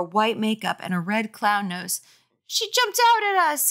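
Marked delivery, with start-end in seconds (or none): 2.5-3.7 put-on much higher tattling kid; high boy voice. A narrator's reading. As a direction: tell on her to mom. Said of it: "he told his mother".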